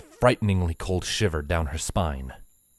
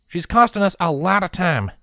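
impatient mare; 10 semitones higher, 4 dB louder than usual. A narrator's reading. Applejack complains about delay.

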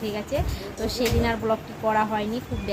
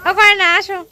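loud high call; 9 semitones higher, 12 dB louder than usual. Real, not acted. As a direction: shout across to be heard. Calling out loudly.

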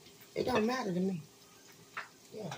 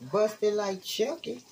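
normal talking voice

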